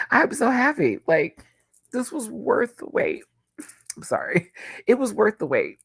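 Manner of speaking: high, teary and happy; emotional. Real, not acted. Moved to tears by her favorite album, high and teary. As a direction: let your voice lift as happy tears come. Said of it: "I'm getting emotional".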